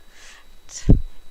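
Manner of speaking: breathy, sneering chuckle; loud scheming serpent laugh; sly snake laugh. Acted. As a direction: laugh off the children's worry. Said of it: "The snake laughed".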